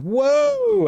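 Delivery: high-pitched, drawn-out collective whoa; mock shocked whoa. Streamer mimicking everyone's reaction to the accent, mock shocked. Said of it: "everyone kind of went".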